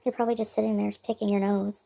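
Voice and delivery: normal talking voice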